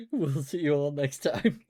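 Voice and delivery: laughing through the goodbye. Real, not acted. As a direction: say goodbye while still laughing. Laughing sign-off.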